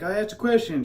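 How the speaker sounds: high-pitched imitation of an insistent woman; put-on high, indignant voice; demanding stranger lady. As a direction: mimic a lady demanding an answer. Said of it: "she comes up and she's like".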